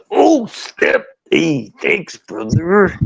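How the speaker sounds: high, drawn-out wrestler voice; slow, stretched mock realisation; put-on wrestler thanks, words dragged out; Macho Man impression. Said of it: "Macho Man story".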